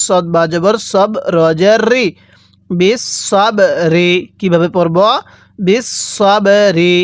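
normal talking voice

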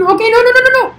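high panicked no, no, no; panicked no's. Real, not acted. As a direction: gamer panic over a near miss. Near miss in game.

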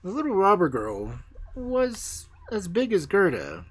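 plain reading voice

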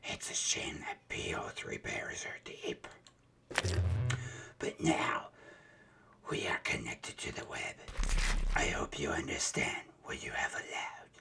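harsh raspy whisper, strained throat. Acted. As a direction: rasp it out in a creepy whisper. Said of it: "That voice hurt my, my throat".

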